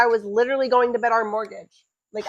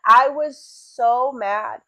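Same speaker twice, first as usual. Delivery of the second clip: ordinary talk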